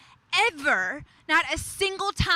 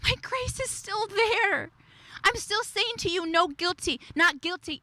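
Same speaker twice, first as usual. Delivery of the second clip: tender voice of God; 6 semitones higher than usual. Pretend. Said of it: "God's reminding you today".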